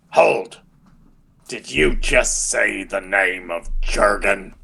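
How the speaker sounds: slow, put-on guard's challenge; challenging guard voice. Hidden guard challenges visitors at the gate, slow character voice. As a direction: halt the visitors, then question them slowly. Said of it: "this voice goes".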